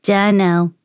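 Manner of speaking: lightly growled monotone reply; growling ghoul. A narrator's reading. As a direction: answer on one note with a hint of growl. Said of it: "growled the ghoul".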